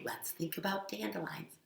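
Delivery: soft whispered suggestion; soft gentle whisper. Acted. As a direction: whisper it soothingly.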